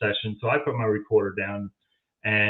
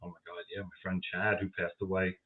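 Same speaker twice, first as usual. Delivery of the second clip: much softer, low inner voice; thinking to oneself; 4 semitones lower, 11 dB quieter than usual. Retold or imitated. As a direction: drop right down as if thinking aloud. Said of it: "I'm like, I'm thinking".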